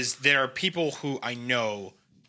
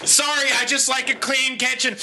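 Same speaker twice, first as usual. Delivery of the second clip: mock passive-aggressive shout; 11 semitones higher than usual. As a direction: yell the apology with fake sweetness. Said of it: "you're like".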